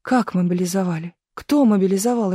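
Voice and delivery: usual speaking voice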